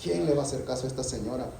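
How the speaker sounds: quick, low and soft, brushing her off; dismissive judge. Retold or imitated. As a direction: scoff at the old woman. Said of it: "¿qué dijo?".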